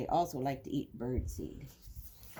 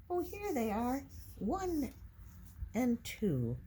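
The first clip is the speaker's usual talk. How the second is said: pleased discovery; 8 semitones higher than usual. Real, not acted. Finding the nuthatches.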